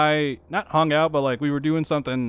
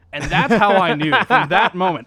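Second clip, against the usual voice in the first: louder, laughing through the line; 3 semitones higher, 8 dB louder than usual. Real, not acted. Telling friends about the rival team hat, laughing louder. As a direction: tell it while cracking up.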